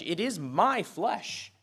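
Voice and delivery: "It is my flesh" said slowly, leaning on "my"; slow stress on my. Real, not acted. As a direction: stretch it out and land hard on "my". Repeats phrase, slowly stressing my.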